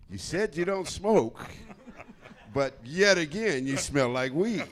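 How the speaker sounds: slow, high, loud singsong reproach; mock accusation. VIP hosts to Rock next time round, pointedly accusing.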